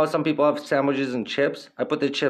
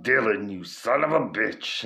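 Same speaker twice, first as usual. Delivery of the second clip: Arnold Schwarzenegger impression. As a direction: growl it like the movie muscleman. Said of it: "He just struts up".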